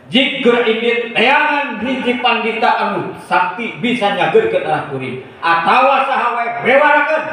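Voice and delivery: high royal decree; commanding king. Acted. King sending minister for a healer, high commanding voice. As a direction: order it in a high, ringing voice. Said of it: "Aya Kanjeng Raja".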